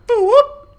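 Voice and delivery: high, loud whooping siren sound; mock siren. Teller imitating the cop car siren, high whoop.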